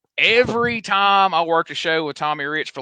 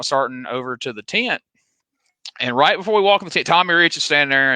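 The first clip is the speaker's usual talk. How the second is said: normal talking voice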